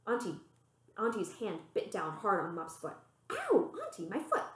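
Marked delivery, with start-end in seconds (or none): 3.3-4.4 sudden yelp. Acted. Auntie grips Mup's foot.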